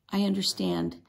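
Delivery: ordinary delivery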